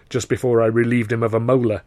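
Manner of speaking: ordinary delivery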